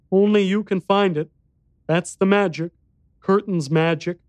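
put-on high-pitched child voice; young boy. A narrator's reading. Boy unable to show the exit, child voice. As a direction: answer as an earnest little boy.

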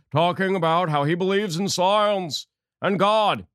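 drawn-out, put-on pompous voice; mock solemn. Host mockingly introducing John Kerry on science and God, mock solemn. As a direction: stretch each word with mock grandeur.

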